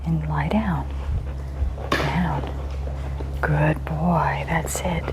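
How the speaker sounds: soft whisper; whispered praise. Real, not acted. Trainer keeping the dog calm on its place, whispered. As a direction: hush him down and praise quietly.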